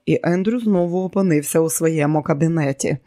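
ordinary delivery

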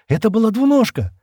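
high, loud, frightened question; trembling question. A narrator's reading. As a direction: ask it in alarm, pitched high. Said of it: "дрожащим голосом спросила она". (испуганный вопрос дрожащим голосом)

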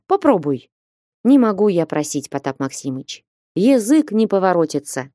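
ordinary delivery, nothing marked

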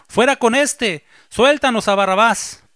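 high, loud mob demand; crowd shouting. A narrator's reading. Crowd demands Barabbas from Pilate, loud high shout. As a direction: yell it like an angry crowd. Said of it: "dio voces a una diciendo".

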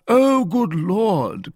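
high, loud, drawn-out exclamation; exclaiming. A narrator's reading. Porthos reacts to Aramis's large hat, loud exclamation. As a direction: cry it out slowly in exasperation. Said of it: "cried Porthos".

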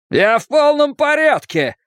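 high-pitched, panicky denial; over-insistent high cry. A narrator's reading. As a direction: insist on it while losing composure. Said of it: "Хейлс говорил высоким голосом".

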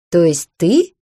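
a touch of laughter in the question; snorting with laughter. A narrator's reading. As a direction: hold back a giggle as you ask. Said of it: "фыркнула я от смеха".

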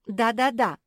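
high, loud, fretful wail; lamenting. A narrator's reading. Marsia agreeing the cellar is awful, lamenting. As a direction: chime in with a lament. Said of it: "запричитала Марсия".

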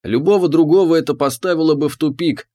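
ordinary delivery

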